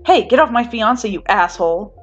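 loud furious yell; angry scream. A narrator's reading. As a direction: scream it at the attacker. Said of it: "Kirishima screams".

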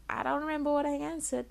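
mock high fluster; high nervous quote. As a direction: squeak it out like a flustered interviewee. Retelling own nervous reply.

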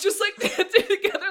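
high, loud laughing words. Real, not acted. Describing the girls dancing together, laughing high and loud. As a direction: laugh through the dancing bit.

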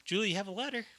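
high call from another room. Retold or imitated. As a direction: call her name upstairs. Mom calling Julie.

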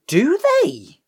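high and loud disbelieving question; genuinely taken aback; stunned by the remake news; surprised. Real, not acted.

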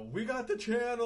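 high, loud, drawn-out holler; yelling out the window. Retold or imitated. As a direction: shout it slowly to someone up on the roof. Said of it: "you're yelling out the window".

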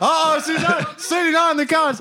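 high-pitched, thrilled hype; excited announcing. Real, not acted. Host hypes co-host's mother taking the couch, excited. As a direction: cheer the guest's arrival in a high voice.

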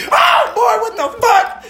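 very high mock shriek; imitating startled scream. Retelling the refrigerator scare, imitating partner's high scream. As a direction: squeal it like a spooked partner. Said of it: "Not just a scream".

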